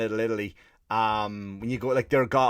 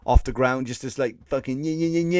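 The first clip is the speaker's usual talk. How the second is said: usual speaking voice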